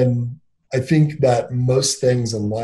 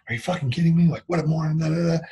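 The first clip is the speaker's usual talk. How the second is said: higher-pitched mock grumble to oneself; cursing under breath; 6 semitones higher, faster than usual. Man retelling his solo work grumbles, cursing under his breath. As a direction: imitate yourself swearing privately at someone. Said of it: "kind of cursing over my breath and going".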